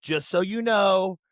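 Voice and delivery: lightly voiced Trump warning the listeners; Trump impression. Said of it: "Trump voice is fun".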